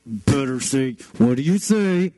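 put-on mom prompting through clenched teeth; warning mother. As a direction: imitate a mother pressing for manners. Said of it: "and the mom's like".

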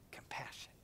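breathy whispered word at the end; tender whispered compassion. Acted. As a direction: let the word fall to a whisper. Whispered again in sermon.

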